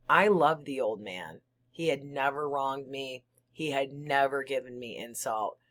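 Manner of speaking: ordinary delivery, nothing marked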